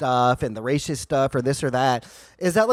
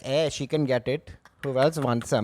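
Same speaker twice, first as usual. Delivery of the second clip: put-on Indian accent; 3 semitones lower, 5 dB quieter than usual. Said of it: "Gandhi, who had the Indian accent".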